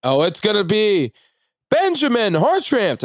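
imitated announcer calling a winner; announcer reveal. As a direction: stretch the name out like a big reveal. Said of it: "And George goes".

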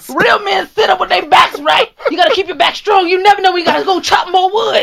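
put-on stern old-school man. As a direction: bark orders like a strict old grandpa. Old-school man scolding young man.

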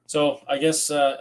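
speaking through a yawn; sleepy, mid-yawn words. Real, not acted. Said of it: "I'm yawning a lot".

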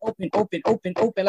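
lightly voiced frantic chant of open; crazy Target lady. Urging the parent to open the box, mock frantic chant. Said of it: "like that crazy Target lady".

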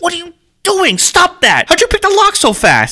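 alarmed. Acted. Friend breaking into a house.

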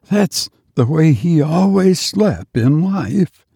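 hollow whisper. A narrator's reading. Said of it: "Biggs' voice was a hollow whisper".

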